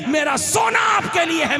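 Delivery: высокий громкий возглас; loud devoted cry. Retold or imitated. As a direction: shout the vow high, with devotion. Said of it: "मोहब्बत का इजहार करके कहते हैं".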